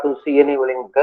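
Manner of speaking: usual speaking voice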